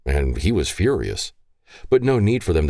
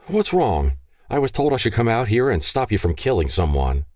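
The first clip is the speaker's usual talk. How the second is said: higher, worried mother's voice; alarmed mother; 7 semitones higher than usual. Acted. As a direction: burst in, worried and a bit high.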